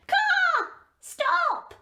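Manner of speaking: high, loud squawking shout; high raven cry. Acted. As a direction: stop the guards, raven. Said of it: "said the raven".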